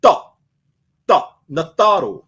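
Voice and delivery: Duh and Natharu with a hard dh between D and T H; hard D J sound. Shown as a demonstration. Teacher contrasting the sound with T H, hard duh. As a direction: bite down on Duh, then Natharu. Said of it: "so similar to a T H".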